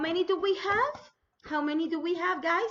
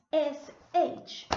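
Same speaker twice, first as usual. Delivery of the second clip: normal talking voice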